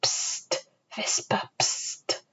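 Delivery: very soft, voiceless hushing whisper; whispering wind. A narrator's reading. Wind in the tall grass, whispering. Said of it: "Der Wind flüsterte".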